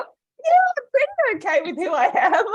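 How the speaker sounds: very high, loud mock reply; hammed-up high self-quote; her own retort. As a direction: squeak out a sure-of-herself answer. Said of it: "I was like".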